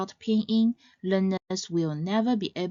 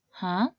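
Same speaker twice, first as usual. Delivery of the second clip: HUH rising from mid-range, like a question; short questioning HUH, upward lift. Shown as a demonstration. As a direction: ask HUH with the pitch sliding up. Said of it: "or when asking a question".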